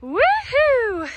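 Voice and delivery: high joyful whoop; woohoo cheer. Real, not acted. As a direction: whoop for the milestone. Cheering at milestone.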